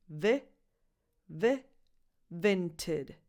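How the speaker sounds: "vented" starting "vih", as in "it"; lax first vowel. Shown as a demonstration. Teacher drilling the app name, "vented" with lax first vowel. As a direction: say "vented" as "VIN-tid", short lax vowel. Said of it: "The first vowel is a lax E".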